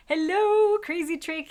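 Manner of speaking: usual speaking voice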